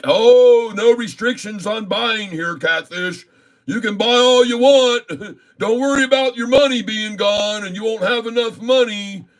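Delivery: high, sneering mock voice with laughs; mocking the trading app. Day trader mocks his trading app, high sneering voice. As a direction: whine it back like the smug app talking down to him.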